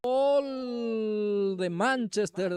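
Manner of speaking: long, high, loud goal call; drawn-out goal shout. Real, not acted. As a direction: stretch the goal cry out at full voice. Goal scored, commentator erupts.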